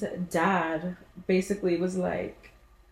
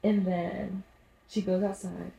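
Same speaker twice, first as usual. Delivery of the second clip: usual speaking voice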